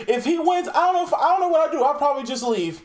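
high, fast whiny voice; Trump impression. Imitating Trump in the ad, high and fast. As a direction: whine it like Trump panicking. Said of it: "Trump was like".

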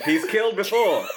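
dramatic radio announcer, laughing; old-timey radio voice. Shown as a demonstration. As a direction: punch the line like a radio thriller. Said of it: "an old-timey radio voice".

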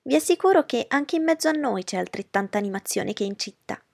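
offended mother retorting to Darcy; offended Mrs Bennet. Acted. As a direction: defend the countryside.

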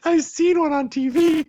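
very high, loud squeal through laughter; real laughter pushing the voice way up; laughing protest. Real, not acted. Laughing protest at being teased.

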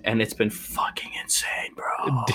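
breathy excited whisper. Real, not acted. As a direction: whisper it like a secret. Excited aside about the day.

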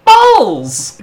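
high comic catchphrase voice; funny voice. Acted. Cast member told to say his only line, funny voice. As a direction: draw it out high and silly. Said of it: "say it in a funny voice".

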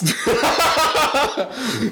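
high-pitched burst of laughter; real laugh, bursting out high. Real, not acted. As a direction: crack up in a high giggle. Laughing at own silly logic.

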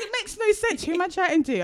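baffled. Retold or imitated. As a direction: sound totally baffled. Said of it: "I was like".

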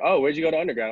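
a hint of a nosy stranger; mock casual questioner. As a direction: toss it off like small talk. Retold question, raised voice.

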